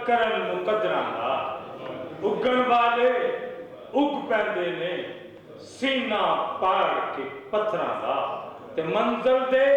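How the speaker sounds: measured recitation, pausing after each phrase; poetry recital. Acted. Poet presenting couplets to the audience, declaimed recital. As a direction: declaim couplets in high, ringing mushaira style.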